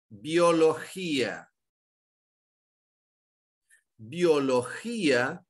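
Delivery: "biologia" spoken very slowly, bi-o-lo-gi-a, each syllable apart; slow syllable split. Shown as a demonstration. Teacher slowly dictating "biologia" to the class, syllables drawn out. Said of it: "vejam a palavra".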